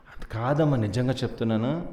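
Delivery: soft, subdued plea to mother; acted lovelorn pleading. Son telling mother he can't live without her, soft pleading. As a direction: imitate a sleepless lovesick son, quietly.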